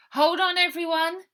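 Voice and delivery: loud high warning cry to everyone; full shout. Acted. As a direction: yell it out over the engine. Ida warns passengers, starting chase.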